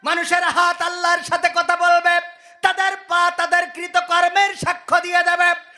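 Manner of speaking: chanting sermon with sudden high-pitched surges; singsong preaching. Acted. Preacher to congregation on animals obeying man, singsong exhortation. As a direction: preach in sustained tones, stretching the phrase endings.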